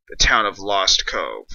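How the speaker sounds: ordinary talk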